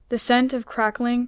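usual speaking voice

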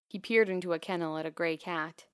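usual speaking voice

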